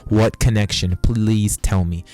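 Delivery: exasperated demand. Real, not acted. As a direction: push the repeat harder, then drag out the plea.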